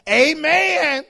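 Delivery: booming, drawn-out high amen; church lingo. Retold or imitated. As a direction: belt out a mock congregational amen. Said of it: "church has its own, own lingo".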